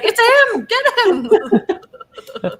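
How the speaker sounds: high, loud shout of alarm; mock panicked yell. Acts out mistaken panic reaction.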